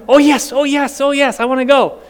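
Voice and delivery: high, excited pet voice; dog answering her owner, high and eager. Acted. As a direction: play the eager dog begging to go out. Voicing dog Cookie eagerly.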